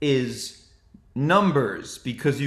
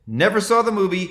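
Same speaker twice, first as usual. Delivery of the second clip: normal talking voice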